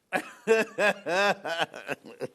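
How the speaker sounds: long real fit of giggles; uncontrolled laugh. Real, not acted. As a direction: burst out laughing helplessly. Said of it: "I'll calm down".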